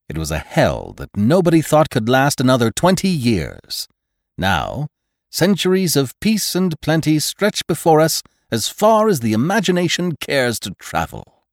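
high, loud visionary speech; exalted doctor. A narrator's reading. Doctor contrasts past hell with plenty, exaltedly. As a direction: proclaim the bright future in a soaring voice.

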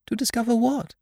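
high, small anxious voice; worried little piglet, pitched well up. Acted. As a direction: ask warily in a high little-creature voice. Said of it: "said Piglet anxiously".

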